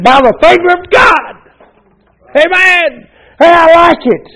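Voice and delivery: high, loud climactic shout; preaching shout. Real, not acted. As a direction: lift it to the rafters on the finger of God.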